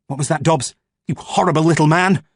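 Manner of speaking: contemptuous rebuke; berating. A narrator's reading. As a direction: tear into the soldier. Boffin berates soldier.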